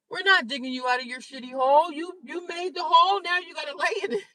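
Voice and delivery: high scolding voice; mock family scolding. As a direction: scold like the family refusing help. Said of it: "That's what my family said to me".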